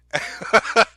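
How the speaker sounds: short burst of laughter. Real, not acted. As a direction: break into a laugh. Laughing at the clip.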